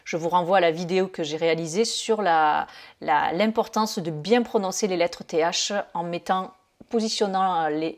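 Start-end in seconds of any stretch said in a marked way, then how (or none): none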